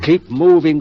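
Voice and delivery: no time to hesitate; sharp order. Acted. Surgeon urging his assistant mid-operation, loud and sharp.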